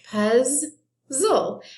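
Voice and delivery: "puzzle" split as puz, zle with a gap; broken into syllables. Shown as a demonstration. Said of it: "I count two syllables".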